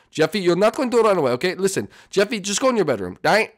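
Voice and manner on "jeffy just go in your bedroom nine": ordinary delivery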